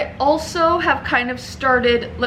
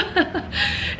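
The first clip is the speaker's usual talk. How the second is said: breathy giggle; 6 semitones higher, 3 dB quieter than usual. Real, not acted. Laughs at loving gift cards.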